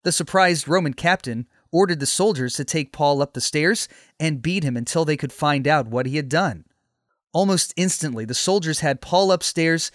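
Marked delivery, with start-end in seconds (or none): none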